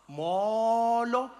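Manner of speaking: "Molo" with a long, wide-open first vowel; open-mouthed Xhosa. Acted. Showing how Xhosa people greet you, "Molo" wide-mouthed and drawn out. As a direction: draw out the "Mo" of "Molo". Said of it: "we open our mouths".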